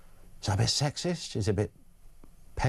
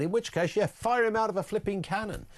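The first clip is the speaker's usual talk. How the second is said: usual speaking voice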